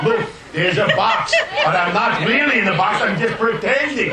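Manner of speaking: hammed-up mime narrating his own act, amused; talking mime. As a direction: overexplain every invisible prop like a proud mime. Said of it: "And he talked like this".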